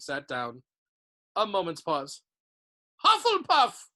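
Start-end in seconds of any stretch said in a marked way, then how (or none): 3.0-3.9 high, loud announcing shout; hat's shout. A narrator's reading. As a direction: call the house out proudly to the hall. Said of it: "shouted the hat".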